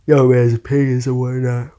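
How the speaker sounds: talking through a yawn; words stretched by a yawn. Real, not acted. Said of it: "sorry about the yawning".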